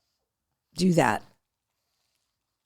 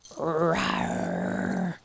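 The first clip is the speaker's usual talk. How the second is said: mock monster growl; 5 semitones lower, 10 dB quieter than usual. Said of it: "sound like a monster".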